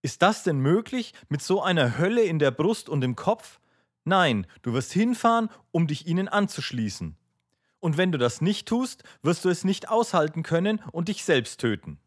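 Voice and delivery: high, loud, urgent remonstration; distressed outcry. A narrator's reading. Aljoscha warning his brother of self-destruction, distressed outcry. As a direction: press the warning with anguished force, pitch held high. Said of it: "rief Aljoscha bekümmert".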